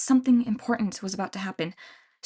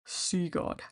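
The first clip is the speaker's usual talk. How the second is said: sense something in the air; mutter; 2 semitones lower, 9 dB quieter than usual. A narrator's reading. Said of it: "he muttered".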